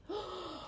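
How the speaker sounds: high startled gasp; quick shocked intake of breath; sharp gasp. Acted. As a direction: gasp as you slip. Giraffe slipping.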